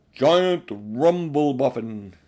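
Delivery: a big slow giant answering. A narrator's reading. Giant answering Aslan from the wall, slow and booming.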